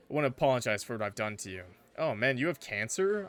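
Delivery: usual speaking voice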